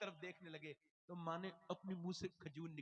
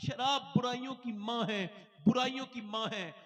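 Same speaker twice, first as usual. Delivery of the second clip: thundering proclamation; 5 semitones higher, 11 dB louder than usual. Acted. Preacher quoting the Prophet, thundering.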